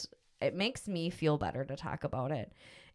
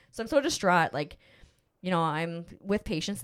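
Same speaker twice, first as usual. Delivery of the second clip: ordinary talk, nothing marked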